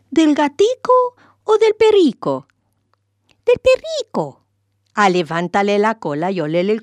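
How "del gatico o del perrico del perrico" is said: put-on childish sing-song. A narrator's reading. Children's game question and answer.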